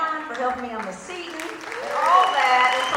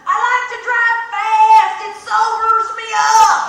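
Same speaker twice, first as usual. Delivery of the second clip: loud, slow, higher shout; shouted over wind; 8 semitones higher, 11 dB louder, slower than usual. Retold or imitated. Mimi yells back to the comedian while driving fast, slow loud shout. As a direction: bellow it over the roaring bike. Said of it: "And she's like".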